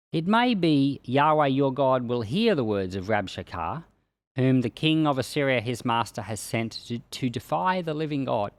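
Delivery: ordinary talk, nothing marked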